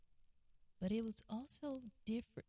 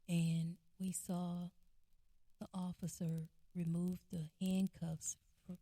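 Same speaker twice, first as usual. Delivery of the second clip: usual speaking voice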